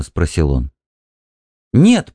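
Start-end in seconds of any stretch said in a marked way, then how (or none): 1.7-2.1 резкое громкое «Нет!» на высокой ноте; выкрикнуть отказ; почти крик. A narrator's reading. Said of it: "почти крикнула она". (почти крикнула испуганно)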